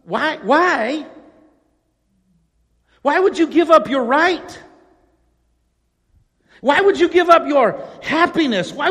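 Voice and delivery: incredulous outsider quizzing a believer, building to loud disbelief; mock incredulous questioning. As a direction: play the baffled critic, pressing harder each time. Mocking critics' bewildered questions.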